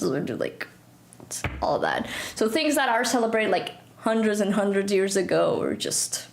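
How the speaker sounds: ordinary talk, nothing marked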